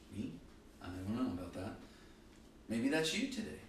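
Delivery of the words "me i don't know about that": low, quiet, unsure aside; hesitant little "who, me?" voice; doubtful. Acted. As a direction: play a sheepish listener, dropped low and soft. Voices doubtful listeners looking around.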